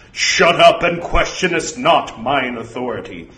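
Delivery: forceful, imperious reprimand; stern false oracle. Acted. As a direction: snap the order as an archaic authority.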